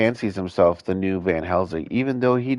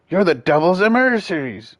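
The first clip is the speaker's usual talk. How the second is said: put-on high eager young hunter voice; puffed-up kid hunter; boastful young hunter; 9 semitones higher, 4 dB louder than usual.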